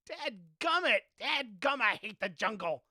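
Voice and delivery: loud, fed-up outburst; frustrated cursing. Real, not acted. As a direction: vent at the jungle. Curses the game aloud.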